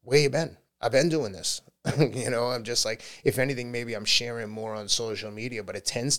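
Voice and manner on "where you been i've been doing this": louder, higher mock indignation; a hint of playful exasperation; mock indignant retort. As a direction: tease them for arriving late. Mock retort to new followers.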